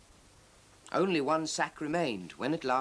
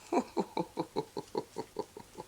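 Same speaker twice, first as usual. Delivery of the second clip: jolly laugh; 7 semitones higher than usual. A narrator's reading. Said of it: "laughed Father Christmas".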